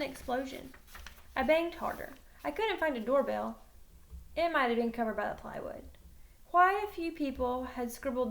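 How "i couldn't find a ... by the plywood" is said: plain reading voice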